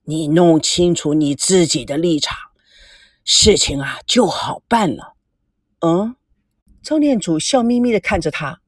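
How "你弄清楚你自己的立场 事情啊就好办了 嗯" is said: a lightly voiced older man, lower; low, measured elder lecturing a young man; stern elder. (低沉的长辈语气)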